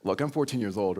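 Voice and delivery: ordinary delivery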